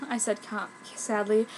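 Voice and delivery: ordinary delivery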